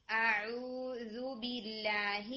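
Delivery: اعوذ very slow, the ain relaxed, not tight. Shown as a demonstration. Teacher shows a relaxed ain in اعوذ باللہ. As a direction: let the throat open on the ain of اعوذ. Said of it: "عین کو relax رکھیں گے".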